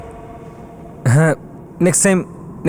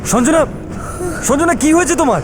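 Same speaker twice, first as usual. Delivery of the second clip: panicked, worried; 14 semitones higher than usual. Acted. Panicked call for doctor.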